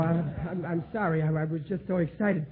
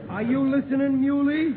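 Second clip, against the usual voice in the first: loud call; 6 semitones higher, 6 dB louder than usual. Acted. Riley calling to Muley to pay attention, loud call. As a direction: raise the voice high to call Muley over.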